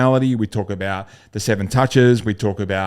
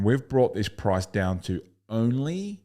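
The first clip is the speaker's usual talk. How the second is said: slow build, then hold a long dramatic pause after only; slower than usual. Shown as a demonstration. Sales coach shows the prospect a dramatic price pause. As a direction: drag out down to only and leave it hanging. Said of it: "dramatic pause".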